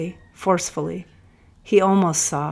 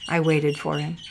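plain reading voice

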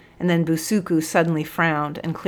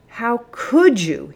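slow, higher indignant bellow; bellowed reproach; 6 semitones higher, 4 dB louder, slower than usual. A narrator's reading. Sergeant confronting Busuku over the boys, bellowing slowly. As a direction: draw out the outraged question. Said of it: "he bellowed".

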